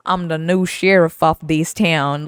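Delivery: slow, loud cowboy swagger; mock sheriff. Teasing boss about his dad's gun, mock sheriff. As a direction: drawl it out like a new sheriff. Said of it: "but you weren't like".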